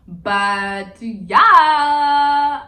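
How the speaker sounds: loud drawn-out yeah. Real, not acted.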